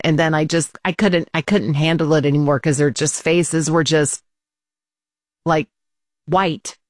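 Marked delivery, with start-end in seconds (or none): none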